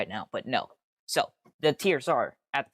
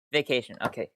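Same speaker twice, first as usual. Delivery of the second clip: usual speaking voice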